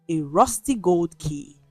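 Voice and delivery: plain reading voice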